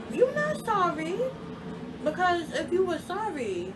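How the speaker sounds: loud, high indignant retort; angry rebuttal. Retold or imitated. Girlfriend rejecting boyfriend's apology, loud indignation.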